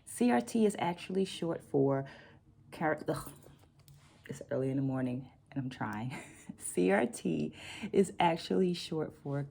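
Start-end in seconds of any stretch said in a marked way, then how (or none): none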